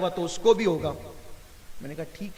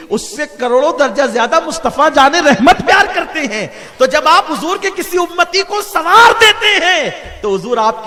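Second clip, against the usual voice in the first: loud, high, impassioned preaching with bursts of laughter; preaching at full force; 4 semitones higher, 11 dB louder than usual. Acted. Preacher telling the congregation of the Prophet's smile, loud and joyful. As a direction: lift the crowd with joyful fervour, loud and soaring.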